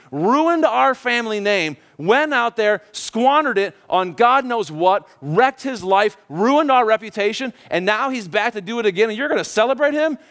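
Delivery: acted resentful rant, pitch up; indignant. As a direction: list grievances with rising outrage, stretching key words. Older son refuses, resentful at father.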